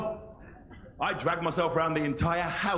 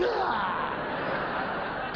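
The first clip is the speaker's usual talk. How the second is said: mock spitting shout; 4 semitones higher than usual. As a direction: spit it like a toddler at the waiter.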